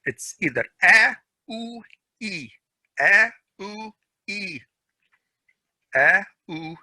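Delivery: a, u, e drawn out long; long a, u, e demo; long vowels. Shown as a demonstration. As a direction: stretch each vowel slowly. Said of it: "the mad vowels".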